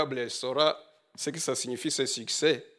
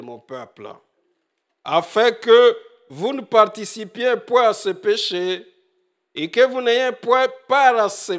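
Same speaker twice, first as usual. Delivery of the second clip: louder, higher proclaiming of the verse; scripture reading; 6 semitones higher, 7 dB louder than usual. Acted. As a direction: proclaim the Bible passage to the hall. Reads scripture aloud. (lecture solennelle, plus forte et plus aiguë)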